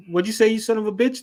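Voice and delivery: quick, high-pitched imitation of an offended artist; hammed-up indignant challenge; mock outrage. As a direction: fire back as if insulted, fast and high. Voicing offended tattoo artist.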